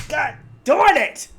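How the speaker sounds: sudden high, loud "God darn it!"; annoyed outburst. Real, not acted. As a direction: snap at the gnats buzzing round you. Swatting gnats mid-sentence.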